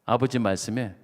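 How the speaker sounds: ordinary talk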